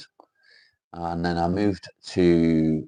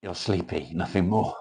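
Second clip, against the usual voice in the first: ordinary talk, nothing marked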